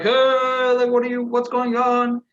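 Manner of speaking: high, loud protesting crowd voice; mock crowd shout. Waiting crowd shouting at the commotion, high and loud. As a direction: call out like a crowd of restless waiting people.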